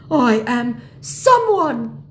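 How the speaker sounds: slow, loud, high declaration; declaring mock scammer. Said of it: "he declares".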